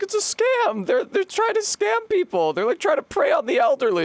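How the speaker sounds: mock high voice arguing with the saw crew; high squeaky self-quote. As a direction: squeak it like a defensive kid. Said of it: "I was like".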